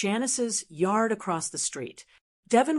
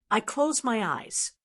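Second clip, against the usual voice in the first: ordinary delivery, nothing marked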